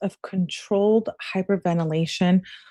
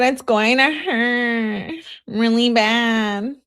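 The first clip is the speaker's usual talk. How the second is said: slow, higher, drawn-out whine; mock whining; 5 semitones higher, much slower than usual. Reading Kenzie's text about needles, mock whining. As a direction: imitate a scared girlfriend, stretching the words.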